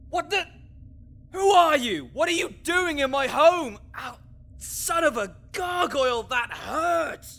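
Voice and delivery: high, loud outraged yelling; startled angry shout. Acted. Necromancer yelling at the intruder, outraged. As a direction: shout down the intruder who hit you. Confronting an attacker in his home.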